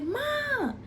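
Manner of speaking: high, strained cry for mom; calling her mom in alarm from the back room; panicked call to mom. Retold or imitated. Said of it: "I'm like".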